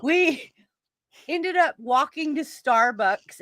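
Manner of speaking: loud, high, through real laughter; laughing exclamation. Real, not acted. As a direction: burst out laughing as you tell it. Laughing over fleeing the closet.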